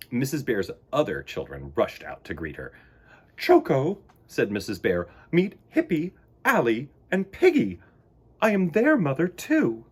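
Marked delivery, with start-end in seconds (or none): 3.4-4.0 high, louder bear mama voice; a warm motherly lead-in. Acted. Missus Bear character voice. 5.3-9.8 high, louder proud mama bear voice; proud mother bear. Acted. Missus Bear character voice.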